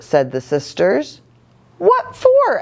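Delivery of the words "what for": very high, louder mother's question; sharp mama question. A narrator's reading. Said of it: "asked Mama".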